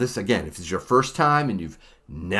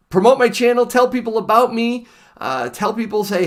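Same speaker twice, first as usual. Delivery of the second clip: pleading; 10 semitones higher, 4 dB louder than usual. Real, not acted. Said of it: "pleading for subscribers".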